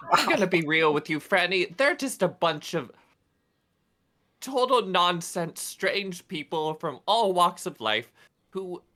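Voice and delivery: normal talking voice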